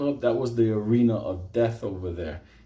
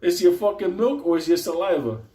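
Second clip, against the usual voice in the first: higher, scoffing outrage; mock outraged guard; 9 semitones higher, 3 dB louder than usual. Guard mocking Benito about the warm milk, higher and scornful. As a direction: sneer at the spoiled inmate. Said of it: "the guy tells Benito".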